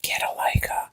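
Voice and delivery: whispered aside, half joking; teasing whisper. Real, not acted. Friend whispers a camera tip as a joke. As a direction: slip in the suggestion under your breath.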